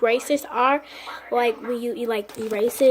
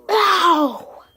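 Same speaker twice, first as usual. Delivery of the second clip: pained ow; 4 semitones higher, 3 dB louder than usual. Real, not acted. Ripping tape off mouth.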